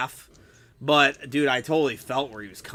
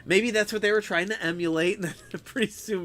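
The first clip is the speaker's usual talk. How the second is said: normal talking voice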